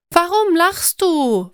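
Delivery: puzzled why the friend laughs; confused question. A narrator's reading. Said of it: "frage ich verwirrt". (verwirrte Frage, höher und langsam)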